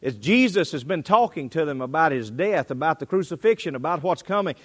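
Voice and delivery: ordinary talk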